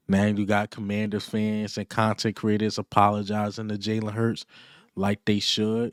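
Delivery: normal talking voice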